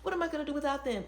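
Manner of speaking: high, fast, panicky whine; mock panicked whine. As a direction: squeal it like a clingy friend. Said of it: "where it's like".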